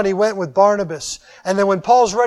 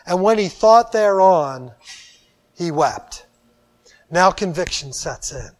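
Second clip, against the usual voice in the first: ordinary talk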